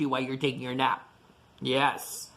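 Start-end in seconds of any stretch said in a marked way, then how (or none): none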